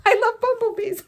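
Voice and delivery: high, loud laughing gush; full-voiced squeal of delight after whispering. Real, not acted. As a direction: burst out with it, laughing. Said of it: "why am I whispering".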